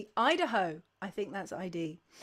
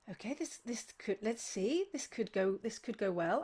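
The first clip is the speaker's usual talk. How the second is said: softly retold inner monologue; tentative self-talk; 3 semitones lower, 6 dB quieter than usual. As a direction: think aloud, soft and unsure. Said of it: "I was like".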